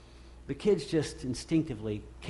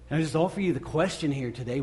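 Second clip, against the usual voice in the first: normal talking voice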